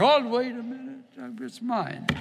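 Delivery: high, loud, eager claim on the best piece; greedy grab. Acted. Preacher acting out a greedy diner grabbing meat, eager and loud. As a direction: pounce on it like a greedy kid at dinner.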